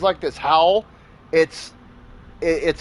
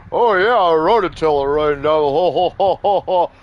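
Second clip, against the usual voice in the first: loud put-on phone chatter with hearty ho-ho laugh; mock oblivious neighbor; 4 dB louder than usual. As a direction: imitate a neighbor guffawing on the phone.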